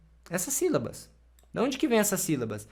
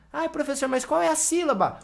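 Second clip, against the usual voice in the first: high-pitched student asking the teacher; higher mock-student plea; questioning student; 8 semitones higher than usual. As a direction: play a puzzled pupil, voice pitched well up.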